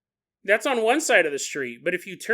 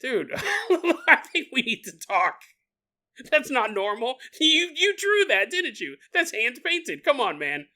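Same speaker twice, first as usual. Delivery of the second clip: laughing, higher incredulous mock worry; hammed-up alarm at a friend's drawing, higher pitched; concerned friend; 5 semitones higher than usual. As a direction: plead with your buddy, half laughing. Confronting friend about noose poster.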